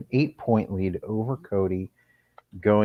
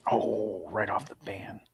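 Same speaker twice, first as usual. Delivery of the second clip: golf commentator; 2 semitones lower, 12 dB quieter than usual. Acted. Hushed putting commentary.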